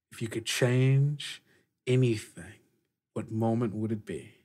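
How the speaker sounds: ordinary talk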